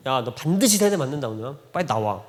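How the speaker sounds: ordinary talk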